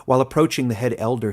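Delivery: usual speaking voice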